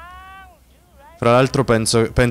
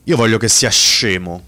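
normal talking voice

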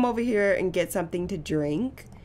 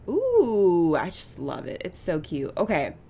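ordinary talk, nothing marked

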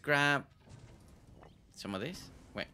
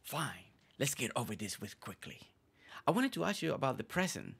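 low, hushed, brooding character voice; deep scary voice; 4 semitones lower than usual. Acted. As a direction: play a secretive dramatic villager. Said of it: "Always with that deep voice".

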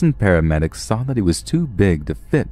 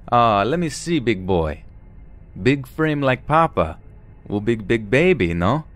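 put-on heavy Russian accent; 4 semitones higher than usual. Stranger holds the snatched baby, cooing in a Russian accent. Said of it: "in a heavy Russian accent".

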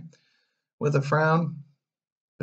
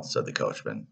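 ordinary delivery

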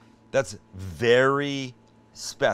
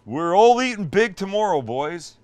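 excited; 7 semitones higher, 8 dB louder than usual. Real, not acted. Juicy brisket reveal, cheering.

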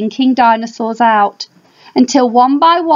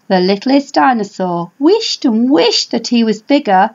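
usual speaking voice

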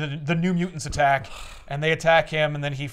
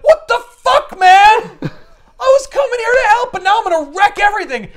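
much higher, louder hammed-up fury; outraged yell; 12 dB louder than usual. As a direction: shriek in comic outrage. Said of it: "And Beyonder's like".

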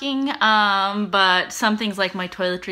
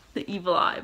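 ordinary talk, nothing marked

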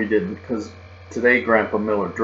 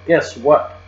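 louder, higher, bursting with news; very excited; 5 semitones higher, 6 dB louder than usual. Acted. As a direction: blurt it out, thrilled. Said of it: "I said, very excited".

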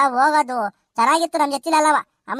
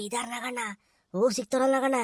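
very soft, low furtive murmur; hushed aside; 3 semitones lower, 10 dB quieter than usual. Acted. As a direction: keep it under your breath among mourners. Furtive remark among funeral guests.

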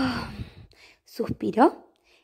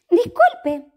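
polite cat voice; 7 semitones higher, 4 dB louder than usual. Acted. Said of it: "le preguntó".

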